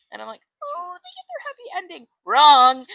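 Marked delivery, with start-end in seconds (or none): 0.6-2.1 very high falsetto coo; sugary, squeaky mock happiness; mock delight. Mock-sweet setup before the twist. 2.3-2.9 loud, raised-pitch burst; sudden loud buzzer-like verdict; emphatic contradiction. Acted. Abruptly knocks down the happy ending.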